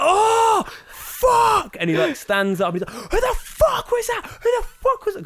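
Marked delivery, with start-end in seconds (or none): 0.0-1.8 high, loud, drawn-out roar; mock the bellow of a man just hit; enraged yell. Retold man struck on head. 2.9-5.2 furious demand. Retold or imitated. Angry man demands the culprit.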